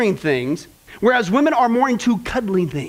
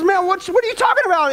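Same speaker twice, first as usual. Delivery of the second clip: mock confused husband; 9 semitones higher, faster than usual. Husband voiced in driving story.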